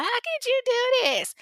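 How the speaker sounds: very high, squealing fake wail; exaggerated high-pitched tearful outburst; mock boo-hooing. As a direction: wail it like a hammed-up heartbroken girlfriend. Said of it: "she's supposedly boo-hooing and flipping out".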